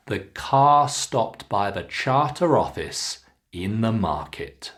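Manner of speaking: long ah in "car", voice drops on "market"; falling intonation. Shown as a demonstration. Teacher models sentence one, falling at "market". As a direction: model it clearly, bringing "market" down. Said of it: "bring it down at the end".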